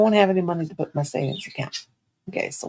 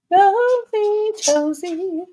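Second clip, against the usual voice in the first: high loud sing-song call to the dog; high sweet doggy call; 12 semitones higher, 6 dB louder, much slower than usual. Real, not acted. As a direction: coo the dog's name up high.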